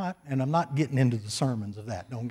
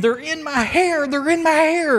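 yelling and screaming; 12 semitones higher, 4 dB louder than usual. Retold or imitated. Pastor imitating a hippie convinced something is in his hair, screaming. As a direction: act out a frantic high-pitched yell. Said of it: "he was yelling and screaming".

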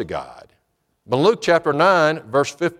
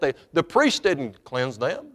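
normal talking voice

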